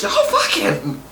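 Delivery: squealing high, egging them on; high-pitched cheering. Retold or imitated. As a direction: shriek like a cheering fan. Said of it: "I'm like".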